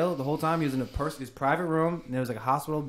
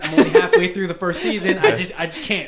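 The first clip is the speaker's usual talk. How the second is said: talking through laughter; can't stop laughing; 5 semitones higher, 9 dB louder than usual. Real, not acted. Laughing hard.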